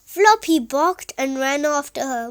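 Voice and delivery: ordinary delivery, nothing marked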